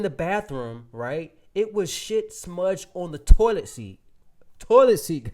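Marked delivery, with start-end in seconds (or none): 1.5-5.3 loud, disgusted retelling of the toilet seat; appalled, raised voice. Retold or imitated. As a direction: spit out the disgust.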